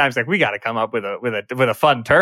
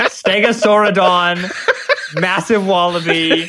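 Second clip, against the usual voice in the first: laughing; 4 semitones higher, much slower than usual. Real, not acted. Listing fake megafauna, laughing.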